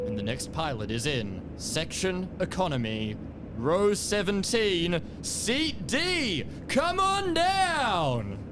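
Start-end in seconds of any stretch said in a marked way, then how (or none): 1.6-8.4 game show host. Pretend. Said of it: "getting you to say the".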